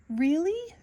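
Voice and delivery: high, astonished question; can't believe it, pitch jumps up; amazed little fish asking really; surprised. A narrator's reading. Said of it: "Nemo asked".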